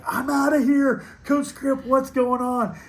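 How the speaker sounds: high, loud panicked student imitation; retold freak-out. Student freaking out to her coach when pictures fall, mock panic. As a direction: act out a student freaking out, pitch shooting up. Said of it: "she started freaking out".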